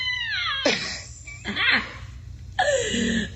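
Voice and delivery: high squealing laugh, long and breathless; helpless laughter. Real, not acted. Host losing it over the tangent, helpless laughter. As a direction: crack up into high-pitched wheezes.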